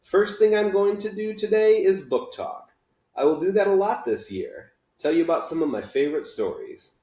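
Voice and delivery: a teacher ready to launch a giant party; teacher announcing book talk to his class, bright and excited; eager teacher. Acted. Teacher voice of Mister Daniels.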